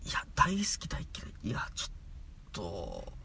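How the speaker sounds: whispered, flustered half-answer. Acted. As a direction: breathe the words out, embarrassed. Flustered, pressed to choose.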